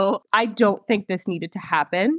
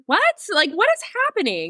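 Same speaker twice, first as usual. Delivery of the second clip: confused; 10 semitones higher than usual. Retold or imitated. Retold confusion.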